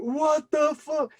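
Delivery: high, slow, stretched cry of alarm; re-enacted shock. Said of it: "But in that instant".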